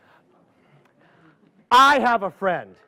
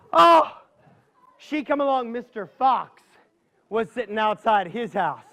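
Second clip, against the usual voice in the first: ordinary delivery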